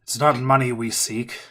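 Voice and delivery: lightly voiced gruff stranger; gruff voice calling out. Stranger answering Milos from afar, mildly gruff. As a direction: answer back, a touch gruff. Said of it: "You hear a gruff voice call out".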